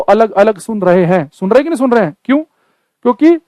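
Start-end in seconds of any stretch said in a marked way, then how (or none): none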